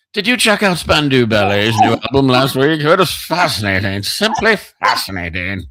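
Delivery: put-on English accent, playing the cultured connoisseur; mock cultured English. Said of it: "feel like I know something about English".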